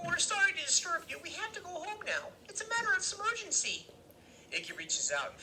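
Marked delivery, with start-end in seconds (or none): 0.1-3.9 very high voice attempting formal dignity; trying to sound grown-up. A narrator's reading. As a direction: play a small kid's failed grown-up voice, still high. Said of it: "tries to make his voice sound deep and more grown up".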